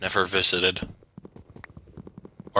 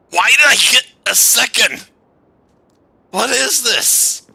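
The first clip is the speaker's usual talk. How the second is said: shrieking confusion, much higher and louder; startled high-pitched what; high squeal of surprise; 5 dB louder than usual. Real, not acted. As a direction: squeal it out in disbelief, slowing at the end. Baffled by a second catch.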